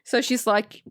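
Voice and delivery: ordinary delivery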